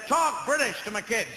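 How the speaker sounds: loud, high American demand; imitating an American dad. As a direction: bark it like a pushy Southern dad. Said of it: "Then he said".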